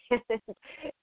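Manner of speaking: high breathy giggle of relief; giddy laugh. Real, not acted. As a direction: let a delighted laugh escape. Laughs after spirit chat.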